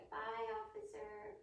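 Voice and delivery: put-on a child saying bye, high and light; chirpy little-kid goodbye; high kid's goodbye. Shown as a demonstration.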